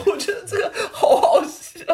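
can't stop giggling; squeaky helpless laugh. Real, not acted. Laughing at partner.

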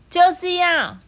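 high, loud stubborn retort; stubborn princess. A narrator's reading. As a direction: snap back like a spoiled princess. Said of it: "听起来就会像是这样".

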